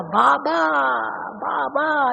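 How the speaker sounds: drawn-out cry to Baba, imitated; retold loud cry. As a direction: imitate calling out for help, stretching the word. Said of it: "इतना जोर से कहा".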